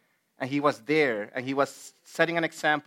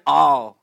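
"All" punched out much louder; stress on All; 11 dB louder than usual. Retold or imitated. Preacher showing what Peter took personally, forceful stress on "All". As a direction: hit "All" hard as a word on its own.

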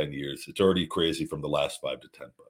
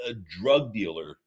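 ordinary talk, nothing marked

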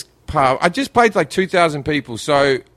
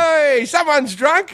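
high, drawn-out merry call; happy, cheery; 10 semitones higher, much slower than usual. Retold or imitated. Comedian to a stumbling drunk stranger, cheery call. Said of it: "like a, like a happy".

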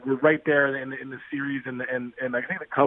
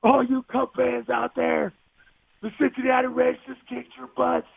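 yelling to the bar; 9 semitones higher, slower than usual. Retold or imitated. Player taunting Cub fans in a bar, high slow yell. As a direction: bellow it across a packed bar, gloating. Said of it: "and I yell".

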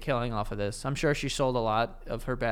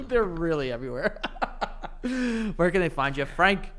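laughing; 4 semitones higher than usual. Real, not acted. Cracking up at the episode's end.

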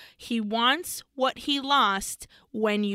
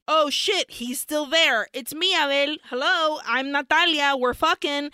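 higher, louder mock urgency; excited calling out; 4 semitones higher, 4 dB louder than usual. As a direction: call out to someone trapped inside. Acts Natalia calling to Abel.

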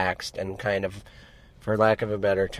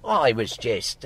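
normal talking voice

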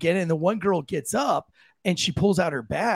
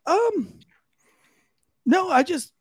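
high, hesitant mock fluster; awkward dodge; 12 semitones higher, 3 dB louder, slower than usual. Comedian dodging a blowjob offer, flustered and higher. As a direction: squirm out of the offer, pitched up. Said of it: "And I was like".

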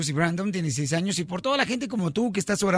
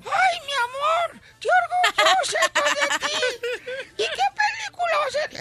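proud mom voice. Acted. Mother voiced in joke.

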